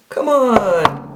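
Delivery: high, imitated female plea; high-pitched pleading woman. Woman begging the hiding man to talk, high mock plea. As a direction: coax him in a put-on high voice. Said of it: "all of a sudden I hear".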